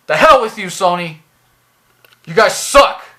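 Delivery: loud, indignant outburst; angry dismissal. Real, not acted. Reviewer signing off on Sony, angry and fed up. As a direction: throw the insult out loudly.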